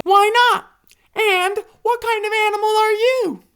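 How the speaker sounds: high hippo question; curious hippo. A narrator's reading. Hippo to panda, high curious voice. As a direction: ask with open curiosity. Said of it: "the hippopotamus says".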